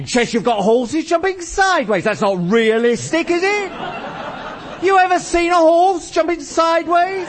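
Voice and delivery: climbing, high-pitched indignation; sarcastic rant. Acted. Game explainer mocking the realism of chess, sarcastic.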